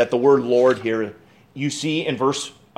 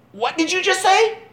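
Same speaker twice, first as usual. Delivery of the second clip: stunned disbelief. Acted. Hezekiah stunned at prophecy.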